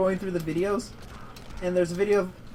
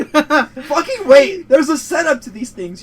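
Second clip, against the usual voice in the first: laughing; 6 semitones higher, 12 dB louder, slower than usual. Real, not acted. Scolding friend for stealing joke.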